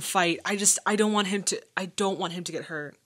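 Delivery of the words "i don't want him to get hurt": ordinary delivery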